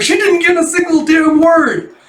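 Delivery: very high, loud mock scream; shocked shriek. Housemaster shocked at child's garbled answer, exasperated shriek. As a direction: scream it in comic disbelief. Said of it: "his shock up".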